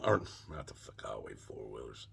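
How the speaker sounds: unvoiced whispered self-correction; hushed aside to the audience. Real, not acted. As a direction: drop to a whisper to correct yourself. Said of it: "it didn't come from that".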